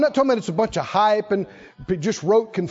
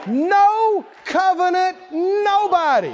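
"No covenant nobody" said slow and high, each word leaned on; slow emphatic repeat; 8 semitones higher, 3 dB louder, slower than usual. Shown as a demonstration. Preacher defines the Philistine to his congregation, slow and emphatic. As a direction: land "No covenant nobody" hard and deliberate. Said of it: "You know who a uncircumcised Philistine is?".

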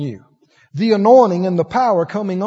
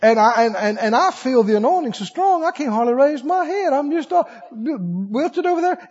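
ordinary talk

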